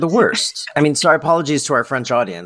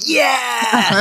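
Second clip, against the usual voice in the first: high, far-off whoop of delight; a sudden high yelp of joy; excited cheer; 11 semitones higher than usual. Retold or imitated. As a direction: cheer like your team just scored. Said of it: "I was like".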